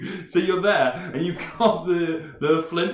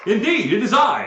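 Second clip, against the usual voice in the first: pompous lord voice; 6 semitones higher, 7 dB louder than usual. Acted.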